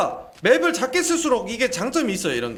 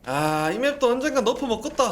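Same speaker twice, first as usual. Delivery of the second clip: ordinary talk, nothing marked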